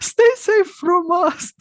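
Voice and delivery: slow, high, laughing punchline; laughing joke. Real, not acted. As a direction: tease it through a laugh. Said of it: "I like the value of the humor".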